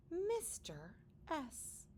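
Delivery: plain reading voice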